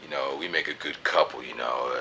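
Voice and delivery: dropped low and soft, a hint of playing it cool; lightly voiced smooth line; low mock flirt.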